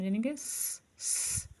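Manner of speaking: स- hissed, breathy, no voice; s sound. Shown as a demonstration. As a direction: hiss स- like a long s. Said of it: "सी का sound". (स- को बिना आवाज़ के सी की तरह फुसफुसाकर)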